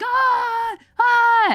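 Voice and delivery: very high, startled yelps; mock panicked cries. Firemen reacting to the fire, hammed-up shrieks. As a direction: squeal in fake alarm. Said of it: "they're like".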